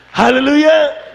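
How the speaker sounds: very high, slow drawn-out shouted Hallelujah; mock shout. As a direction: stretch "Hallelujah" out high and loud like a panicked mother. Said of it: "Nung sumigaw yung nanay niya".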